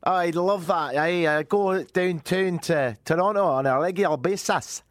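keen Scottish customer; put-on Scottish accent. Customer accepting a discount offer, put-on Scottish accent. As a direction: answer eagerly with a Scottish lilt. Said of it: "Scotland's good".

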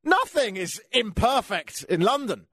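slow, high, mock-blissful voice; mock contentment. Mocking himself as a happy expat back in London. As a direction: drawl it out like someone finally content. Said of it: "get there and go".

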